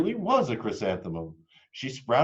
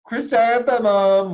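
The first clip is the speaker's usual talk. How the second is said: high, very loud, drawn-out call; mocking children; 12 semitones higher, 11 dB louder, slower than usual. A narrator's reading. Classmates crying out her name to mock her, very loud. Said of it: "was the answer".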